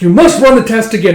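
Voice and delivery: loud, high-pitched insistence; acted alarmed demand; urgent protest. Acted protest over the test.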